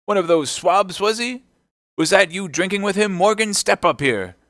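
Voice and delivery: high, loud interrogation; outraged landlord calling a man out; indignant summons. Acted. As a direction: demand answers across the room. Silver angrily summons a drinker.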